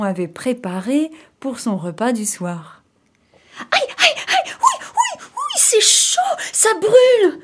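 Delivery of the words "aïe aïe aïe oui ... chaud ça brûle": very high falsetto yelps of pain; frantic, high-pitched acted howling; scalded wolf. A narrator's reading. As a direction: squeal as if dropped in boiling soup. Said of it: "disait le loup en essayant de s'échapper".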